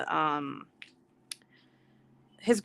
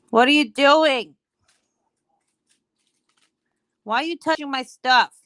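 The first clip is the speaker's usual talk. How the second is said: exclaimed scolding; 6 semitones higher, 5 dB louder than usual. Real, not acted. Mom catches baby touching her stuff, scolding.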